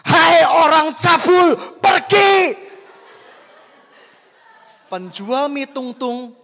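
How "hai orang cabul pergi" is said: loud, high, drawn-out command yell; acted bellow of rebuke; authoritative shout. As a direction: shout it with full authority to drive someone away. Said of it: "dia teriak seperti ini. Teriakannya apa?".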